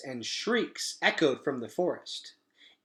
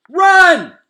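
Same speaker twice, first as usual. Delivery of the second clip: very high, loud cry; squawked shout; 19 dB louder than usual. Acted. Gosling telling mother to escape, shrill shout. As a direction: scream it like a goose. Goose tells mother flee.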